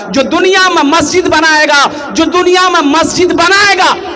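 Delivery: ringing oratory rising to a high peak; full-force preaching. Real, not acted. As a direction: proclaim the promise to the whole crowd. (जोशीली तक़रीर, बुलंद आवाज़ में)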